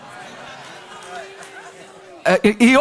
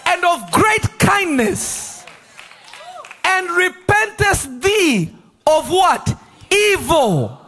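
raised, slow preaching read of Jonah's prayer; preaching scripture; 5 semitones higher, slower than usual. Acted. Preacher reading Jonah's complaint to the Lord, preaching. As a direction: lift the Bible verses high and slow.

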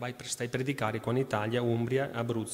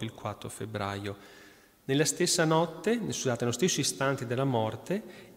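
lettura solenne, ritmo regolare; lettura agiografica; 6 dB louder than usual. Acted. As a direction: racconta la vita del santo al pubblico. Reads saint's life aloud. (lettura devota ad alta voce)

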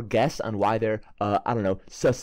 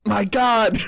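sudden loud higher "My God!"; shocked gasp; 10 semitones higher, 7 dB louder than usual. Real, not acted. Player shocked by the NPC's answer, loud exclaim. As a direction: caught off guard by the reveal.

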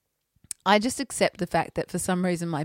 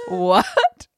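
high "what?" breaking into laughter; laughing disbelief; 8 dB louder than usual. Retold or imitated. Retelling mum's odd word again.

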